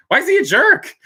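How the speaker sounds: high, loud, squeaky disbelief; surprised viewer asking the reason; incredulous. Real, not acted. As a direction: shoot it up high in amazed disbelief. Reacting to "my father is a jerk.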